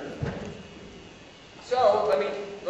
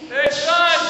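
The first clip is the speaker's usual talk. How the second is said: raised voice, higher pitch; calling out; 5 semitones higher, 6 dB louder than usual. Acted. Father to his son at dinner time, calling out. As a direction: call a child in for dinner.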